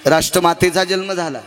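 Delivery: slow, ringing sermon proclamation; solemn kirtan announcement, slow. Acted. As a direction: announce it slowly to the whole crowd. Proclaiming Vivekananda's birthday.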